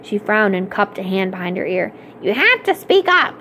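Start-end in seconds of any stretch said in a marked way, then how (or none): 2.2-3.3 high-pitched demand from the librarian; hard-of-hearing librarian. A narrator's reading. Librarian cupping her ear at a girl, demanding in high voice. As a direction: pitch it well up, straining to hear.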